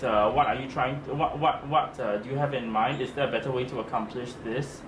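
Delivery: ordinary talk, nothing marked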